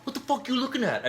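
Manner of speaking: high, loud mock aggression; mock hostile snap. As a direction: fire back at a gawker. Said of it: "if I was like".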